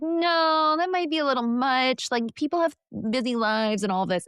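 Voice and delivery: a high-pitched "no" easing down into excuses; doubting inner voice. Retold or imitated. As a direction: voice your hesitant mind, pitched well up. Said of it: "my mind was like".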